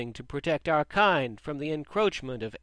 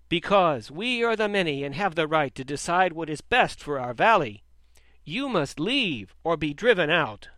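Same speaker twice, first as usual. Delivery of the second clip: angry; 3 semitones higher, 6 dB louder than usual. A narrator's reading. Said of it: "Sheep grew angry at this".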